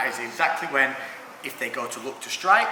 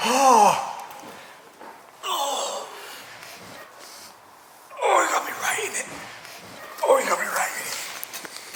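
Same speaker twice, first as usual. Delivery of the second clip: high pained groans; hurt after a knee to the gut; groaning in pain; 12 semitones higher, slower than usual. Real, not acted. Hit in the body.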